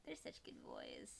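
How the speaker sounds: fond whisper about the cats; whispered doting aside. Real, not acted. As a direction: breathe it out unvoiced, doting.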